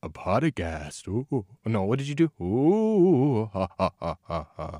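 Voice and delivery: low, slow haunting ghost voice; spooky scary voice. Shown as a demonstration. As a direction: moan it like a ghost. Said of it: "can I try the spooky voice".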